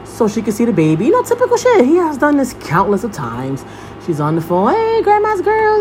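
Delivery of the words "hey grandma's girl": high, drawn-out cooing hello; doting grandma greeting. Retold or imitated. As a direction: imitate gushing over a grandbaby on a call. Imitates greeting on video call.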